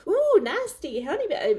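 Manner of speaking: high swooping "ooh", mock American; attempted American accent. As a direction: imitate a viral American narrator, half-attempted. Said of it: "not gonna do an American accent".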